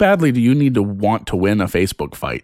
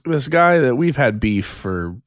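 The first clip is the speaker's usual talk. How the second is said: normal talking voice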